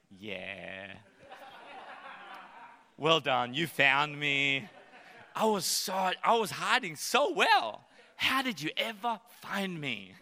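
amused God humoring a proud seeker; playful God voice. Retold or imitated. God gently teasing believer who found him, laughing. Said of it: "picture God in heaven going".

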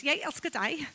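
soft, low Danish accent. Shown as a demonstration. Visiting preacher showing off her one sentence, Danish accent. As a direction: murmur it lightly with a Danish accent. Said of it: "I even say it with the accent".

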